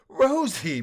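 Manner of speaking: high, bright call of joy; enthused. A narrator's reading. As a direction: call out to a dear friend. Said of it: "Balloony enthused".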